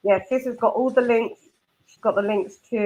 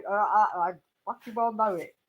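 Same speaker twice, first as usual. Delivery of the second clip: ordinary talk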